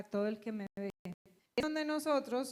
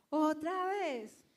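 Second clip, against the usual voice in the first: slow, drawn-out grumble of annoyance; exasperated husband; 4 semitones higher, much slower than usual. Retold or imitated. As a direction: drag it out like a fed-up husband. Husband complains to police. (esposo fastidiado, arrastrando las palabras)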